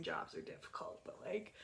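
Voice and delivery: whispered aside. Real, not acted. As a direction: drop to a whisper, like a secret. Aside about stylist.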